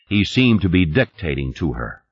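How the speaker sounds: ordinary delivery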